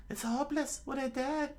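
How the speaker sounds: high falsetto despair; fearful put-on female voice; Nami, frozen in fear. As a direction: give up in a small, very high girl voice. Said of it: "frozen in fear".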